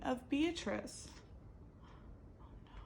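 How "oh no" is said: breathless whispered worry. Real, not acted. Narrator worrying aloud to listeners, whispered. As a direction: whisper it in alarm. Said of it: "narrator aside whisper".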